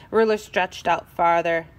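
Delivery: plain reading voice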